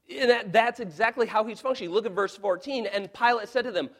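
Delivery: loud, high impassioned preaching; preaching at full force. Acted. Pastor pleading Pilate's case to the congregation, loud and impassioned. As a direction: thunder it from the pulpit.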